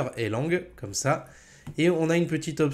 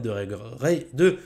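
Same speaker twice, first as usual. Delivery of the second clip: tongue-tied, restarting the word louder; stumbling over words; 5 dB louder, slower than usual. Real, not acted. Presenter fumbling his closing instructions to viewers, stumbling over words. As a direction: trip on the word, then push it out. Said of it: "j'y arrive".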